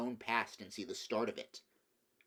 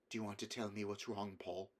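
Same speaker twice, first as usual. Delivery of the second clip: much softer, careful; a mother gently asking her son; gentle probing; 7 dB quieter than usual. A narrator's reading. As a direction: coax it out of him tenderly.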